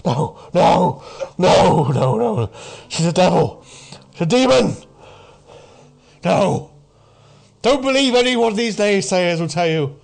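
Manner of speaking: high, loud, agitated warning; distressed doomsayer. Acted. Man with hand to head warns against naysayers, distressed insistence. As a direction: insist in distress that nobody believes you. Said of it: "you can clearly see he's in distress".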